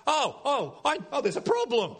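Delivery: high-pitched dawning discovery, mock alarm; sudden realisation. Preacher acts out a believer noticing missing joy, mock realisation. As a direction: act out spotting a problem, pitched high. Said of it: "for us to go".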